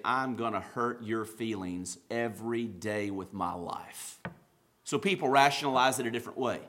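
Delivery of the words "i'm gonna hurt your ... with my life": low, slow and subdued; grave confession. Acted. Sinner speaking to God's face about hurting him, grave confession. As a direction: say it low, slowly and softly.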